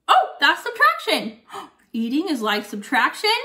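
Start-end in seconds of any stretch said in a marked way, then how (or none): 0.1-1.7 high, bright realisation; mock surprise. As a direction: act amazed at your own trick. Eats Skittle, acts amazed.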